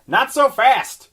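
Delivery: high, slow teasing witch; old hag. Acted. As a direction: purr it like a sly crone. Pickle's voice in story.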